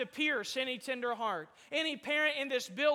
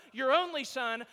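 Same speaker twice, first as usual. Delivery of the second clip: normal talking voice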